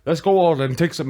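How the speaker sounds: ordinary talk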